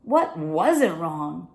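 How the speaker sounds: usual speaking voice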